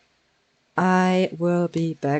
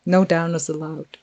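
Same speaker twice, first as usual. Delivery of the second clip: ordinary talk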